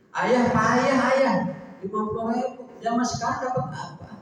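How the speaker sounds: high, mocking scold; a wife calling her husband useless; scornful wife. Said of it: "Suaminya digeblek-geblekin".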